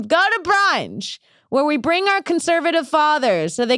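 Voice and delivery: high, stretched call; mock rally cry. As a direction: holler it like a slogan. Calling out mock political demand.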